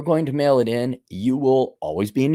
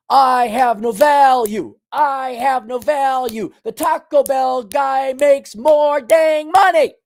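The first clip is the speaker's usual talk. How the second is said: high, slow, hammered-out words; mounting exasperated chant; drill-like declaration; 9 semitones higher, 5 dB louder, slower than usual. Shown as a demonstration. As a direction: hammer out each word, high and stretched. Leading the mirror chant.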